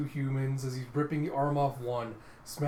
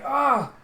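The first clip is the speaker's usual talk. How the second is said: high, loud "ah!"; pained scream; 11 semitones higher, 11 dB louder than usual. Acted. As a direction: cry out reeling from pain. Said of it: "just reeling from all the pain".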